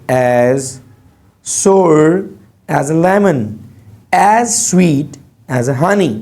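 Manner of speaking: very slow, word-by-word classroom read-out; slow example reading. Shown as a demonstration. Teacher reading example similes to students, slow measured read-out. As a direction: space out each simile for the class.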